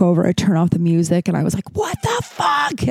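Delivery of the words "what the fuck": high-pitched, drawn-out retold yell; acted-out furious squawk; angry outburst. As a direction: screech it slowly in outrage, without full volume. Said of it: "I was so angry".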